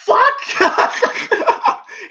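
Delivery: breathy high-pitched laugh; a real burst of giggling; wheezing laugh that cracks up high. Real, not acted. Laughing at the robot scene.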